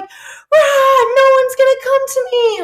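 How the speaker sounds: high, loud wailing; hammed-up yell of romantic despair; mock meltdown. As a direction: imitate a self-pitying tantrum. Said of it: "the more you're like".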